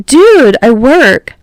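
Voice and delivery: startled protest. A narrator's reading. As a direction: push back, surprised.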